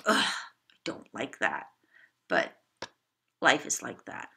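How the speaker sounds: put-on a kid grumbling but letting it go; grudging child's acceptance. Shown as a demonstration. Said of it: "to something which is like".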